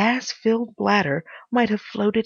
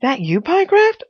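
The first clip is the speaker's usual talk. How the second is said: raised, higher calling voice; shouted question; 7 semitones higher, 6 dB louder than usual. A narrator's reading. Visitor calling to Pycraft through the door, shouting. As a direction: call out to him through the door. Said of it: "I shouted".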